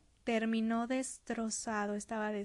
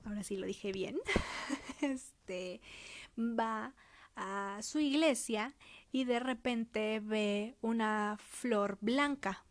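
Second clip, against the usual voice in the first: ordinary talk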